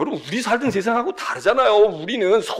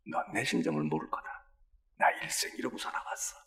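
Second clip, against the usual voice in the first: softer, hushed hurt mother; whispered lament; 14 dB quieter than usual. Acted. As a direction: murmur her grief.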